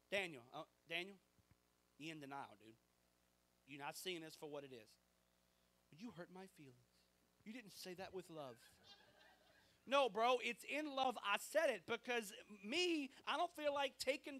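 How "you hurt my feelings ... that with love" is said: a soft, whiny imitation of someone offended; mock hurt whine. Preacher mocking an offended listener, soft sulky whine. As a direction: mimic a touchy churchgoer, quietly sulky.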